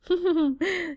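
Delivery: high, put-on indulgent laugh; forced indulgent laugh. A narrator's reading. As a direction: fake a laugh to hide her fear. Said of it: "Janet forces an indulgent laugh".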